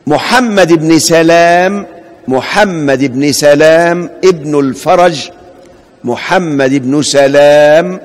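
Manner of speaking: سلام with a single light L, sa-lam, said slowly; careful slow dictation, سلام with a short single lam. Shown as a demonstration. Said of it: "أنا نطقت اللام مخففة".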